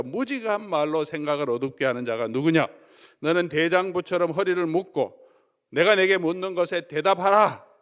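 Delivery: loud, high thundering rebuke; God rebuking Job at full force; scolding God. Retold or imitated. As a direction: thunder down at Job like an angry master. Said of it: "야단을 치는 거죠".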